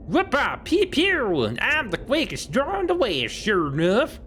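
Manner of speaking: wild west showoff, high and excited; cowboy voice. Pretend. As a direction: shoot imaginary pistols like a gunslinger kid. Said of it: "Gabby doesn't talk like that".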